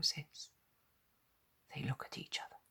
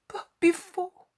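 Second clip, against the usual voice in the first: much higher, louder anxious question; anxious Peter; 10 dB louder than usual. A narrator's reading. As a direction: ask with sudden worry.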